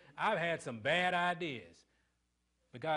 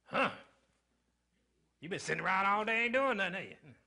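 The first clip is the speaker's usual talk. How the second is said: louder, fast sneering jab; mock rude husband taunting his wife; snide husband; 4 dB louder than usual. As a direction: needle her with a sarcastic accusation. Pastor mocks rude husband.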